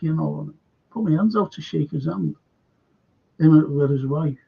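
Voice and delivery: plain reading voice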